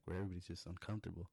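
ordinary talk

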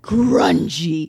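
grungy said in a low, dark growl; gritty voice. Shown as a demonstration. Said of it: "quiet version that is also dark, grungy".

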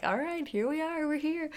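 high, cheery doctor's voice; upbeat doctor walking in, pitched high; doctor arriving. Retold or imitated. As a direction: breeze in chirpy and high. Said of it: "and she's like".

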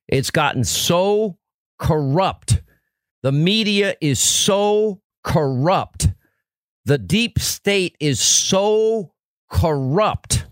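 slow, weighty refrain with SO held out each time; drawn-out emphatic lament. Real, not acted. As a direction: stretch SO long and high, pause, then drop CORRUPT. Host laments corruption in repeated refrain.

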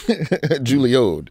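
"Juilliard" turned into "Julio'd", drawn out; knock-off Juilliard pronunciation. Shown as a demonstration. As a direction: say "Juilliard" with an "-ode" ending, like the knock-off. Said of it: "knock of Juilliard".

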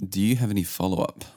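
ordinary talk

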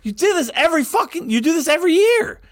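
high mock scolding wife; imitating exasperated wife. Retelling his wife scolding him over the picks, high mock scold. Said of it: "she goes".

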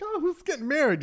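high-pitched gushing question; nosy stranger. Acted. Stranger quizzing a supposed wedding party, nosy gushing. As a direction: pitch it way up like an eager busybody.

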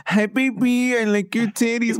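very high mocking baby talk. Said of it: "so you baby talk".

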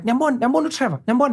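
high-pitched excited calling to family; rapid, repeated summons in a put-on high voice; acting out someone pointing him out; imitated schoolmate. Schoolmate calls her family over.

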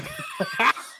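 airy high laugh that bursts out after the punchline; high breathy laugh. Real, not acted.

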